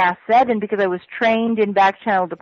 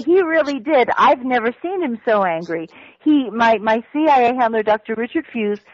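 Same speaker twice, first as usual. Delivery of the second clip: ordinary talk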